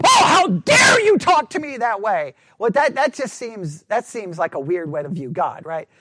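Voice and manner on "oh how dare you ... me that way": high, loud indignant outburst; mock offended shout. An imagined offended God scolding the people. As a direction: ham up a touchy, offended God. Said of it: "That God's just there going".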